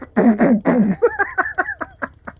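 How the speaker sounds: very high squeal of laughter. Real, not acted. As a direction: break into shrieking laughter. Laughing at own joke.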